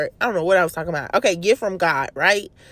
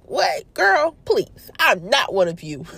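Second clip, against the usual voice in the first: high-pitched incredulous brush-off; mock-indignant and sassy; sassy dismissal; 5 semitones higher, slower than usual. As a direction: scoff it high, playing the offended brother. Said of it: "he kinda like".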